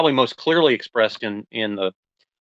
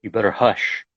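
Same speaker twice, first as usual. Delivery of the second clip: measured, deliberate poetry reading; poem reading; 3 dB louder than usual. Acted. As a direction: read it like a poem, letting each image land. Reads a poem aloud.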